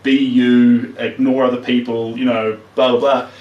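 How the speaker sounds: normal talking voice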